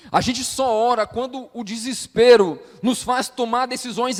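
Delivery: loud, high-pitched preaching at full force; pregação inflamada. Real, not acted. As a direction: build to a loud, urgent pulpit cry. (pregação em voz alta e exaltada)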